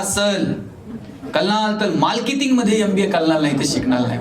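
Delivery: imitating a student who turns "र" into "ल", as in "कल्लाल"; mock speech impediment, L for every R in "मार्केटिंगमध्ये"; lisping R. Student stands up to insist to his teacher, imitated with "कल्लाल" for करणार. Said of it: "त्याला र बोलता यायचं नाही".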